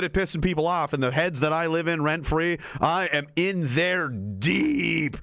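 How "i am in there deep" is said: slow gloat. Real, not acted. Host gloating about trolls, slow and drawn out. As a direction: savour each word, very slow.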